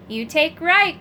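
croaking. A narrator's reading. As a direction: croak it like a frog-man. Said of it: "croaked Urk".

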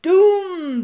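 loud, high, booming drawn-out word; big exasperated holler; bellow. A narrator's reading. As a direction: bellow it at someone hard of hearing. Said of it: "bellowed Urk".

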